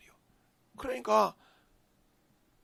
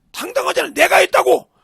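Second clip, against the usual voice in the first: bold shout; 11 semitones higher, 20 dB louder than usual. Real, not acted. Preacher shouts David's boldness.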